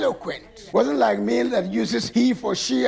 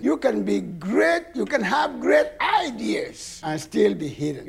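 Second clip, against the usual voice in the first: ordinary talk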